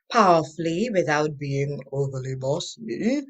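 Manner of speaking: normal talking voice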